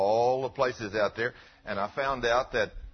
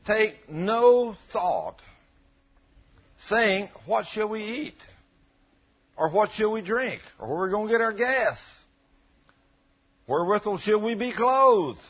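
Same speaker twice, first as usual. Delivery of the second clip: preaching scripture; 9 semitones higher, 6 dB louder than usual. Acted. Preacher proclaiming the verse to the congregation, loud. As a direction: proclaim the verse with full force.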